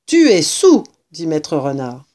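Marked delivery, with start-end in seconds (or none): 0.1-0.8 louder, higher pronouncement; accusing. A narrator's reading. Mister Fox to the tippling rat, loud accusation. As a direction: state it loudly at him.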